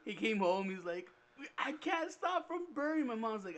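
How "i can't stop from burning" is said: high, drawn-out acted panic; mock distressed wail. Pepper-sprayed boy coming home to mom, distressed wail. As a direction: wail it slowly, pitched way up.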